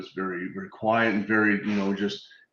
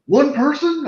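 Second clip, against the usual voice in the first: incredulous son; 6 dB louder, slower than usual. Retold or imitated. Son's doubt voiced.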